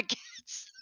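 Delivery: ordinary talk, nothing marked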